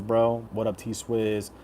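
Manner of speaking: usual speaking voice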